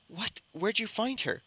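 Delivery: high-pitched astonishment; startled question. Acted. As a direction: pitch jumps up in acted surprise. Friend startled by the girl.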